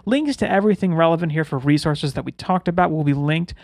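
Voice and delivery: ordinary talk, nothing marked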